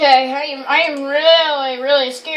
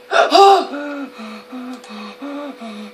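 frightened gasp; 4 semitones lower than usual. Real, not acted. Jump scare in horror game.

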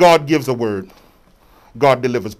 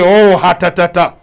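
fake fervor; 4 semitones higher, 8 dB louder than usual. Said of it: "Stop faking and shaking".

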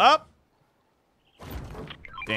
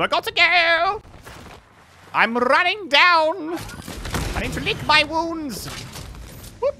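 excited yelling; 11 semitones higher than usual. Real, not acted. Gamer retreating hurt, excited yells. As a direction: shout while running away.

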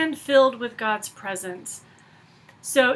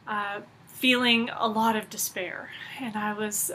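ordinary talk, nothing marked

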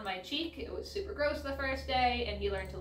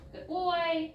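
high praise; 8 semitones higher, 4 dB louder than usual. Real, not acted. Praising dog after spin.

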